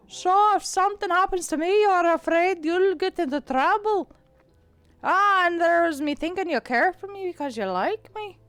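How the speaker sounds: out of the side of the mouth, rolling the R's; Irish accent, side of the mouth. Acted. Cat teasing Kara about her duty of care, Irish accent. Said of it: "I'm losing my Irish".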